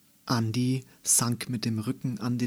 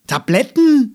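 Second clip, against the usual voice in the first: much higher, much louder indignant cry; furious disbelieving shout; scolding outburst; 12 semitones higher, 15 dB louder than usual. A narrator's reading. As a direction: burst out in outrage. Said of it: "schimpfte Mama plötzlich".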